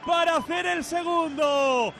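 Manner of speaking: slow, lifted replay call; goal commentary. Real, not acted. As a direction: savor the goal.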